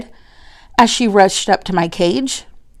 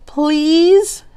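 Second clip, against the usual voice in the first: pleading; 8 semitones higher than usual. Acted. Children pleading.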